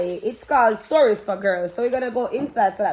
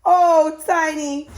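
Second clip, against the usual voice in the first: tearful cry; 4 semitones higher, 6 dB louder, slower than usual. A narrator's reading. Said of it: "she cried".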